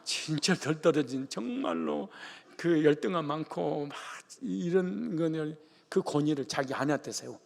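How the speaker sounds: ordinary delivery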